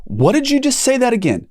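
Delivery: brother's high surprised question. Retold or imitated. Brother on the phone, surprised at the news, asking high. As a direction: pitch it up high in disbelief. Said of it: "all of a sudden he goes".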